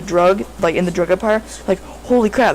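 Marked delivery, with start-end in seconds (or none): none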